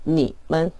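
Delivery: 님은 quiet, 은 cut short lightly; soft clipped demo. Shown as a demonstration. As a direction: clip 님은 softly. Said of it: "가볍게 끊어주시면 됩니다".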